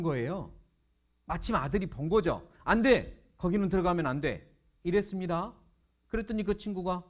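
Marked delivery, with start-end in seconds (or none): 2.6-4.5 acted alarmed warning; urgent forbidding shout. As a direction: call out to block someone from going in. Son stops friend entering room.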